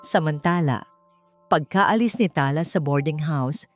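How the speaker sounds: usual speaking voice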